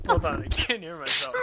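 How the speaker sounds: ordinary delivery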